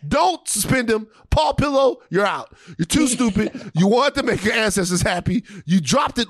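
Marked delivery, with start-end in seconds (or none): none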